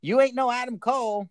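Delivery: high-pitched mock Cameron Grimes voice; a lightly done Grimes imitation; Grimes impression. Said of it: "[name] busts out his own Grimes impression".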